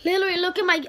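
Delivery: loud, high and worked up; excited shout. Real, not acted. Kid showing viewers his channel, loud excited shout. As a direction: burst out at the camera.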